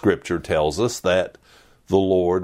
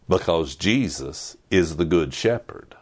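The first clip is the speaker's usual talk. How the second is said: normal talking voice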